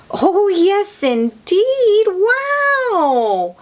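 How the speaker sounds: wowed by the story; delighted wow. Real, not acted. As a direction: marvel out loud. Teller marvels at adventure.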